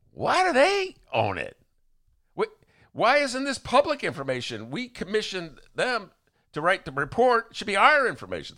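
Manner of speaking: high-pitched, emphatic challenge; indignant objection. Retold or imitated. Retelling an objection to the governor's answer, indignant. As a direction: demand it in mock outrage.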